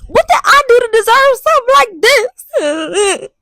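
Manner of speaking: high, hammed-up tearful lament; drawn-out theatrical sobbing plea; melodramatic wailing. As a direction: cry out to the heavens in mock despair. Melodramatic lament after the meltdown.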